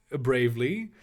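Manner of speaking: plain reading voice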